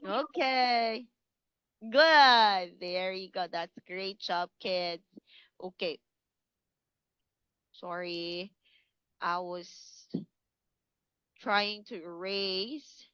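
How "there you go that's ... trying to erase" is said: quiet aside. Real, not acted. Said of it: "aside while erasing the board".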